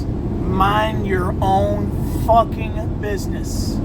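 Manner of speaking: each word slow and heavy, "Mind" hit hardest; slow emphatic advice. Real, not acted. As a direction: spell it out word by word. Life lesson, hammered home.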